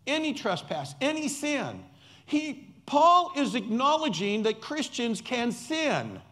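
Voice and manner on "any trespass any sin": Any leaned on, a little higher; stress on any. Shown as a demonstration. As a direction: hit the word Any each time. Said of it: "I want you to emphasize the word any".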